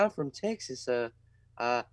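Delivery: high, soft-voiced old lady from Texas. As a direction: imitate a cute little Texan old lady. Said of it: "this old lady comes up to me".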